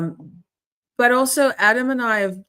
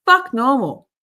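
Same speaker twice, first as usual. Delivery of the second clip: higher, louder defiant cry; loud defiant shout; 7 semitones higher, 7 dB louder than usual. Real, not acted. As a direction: shout Fuck normal with defiance. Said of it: "repeated louder".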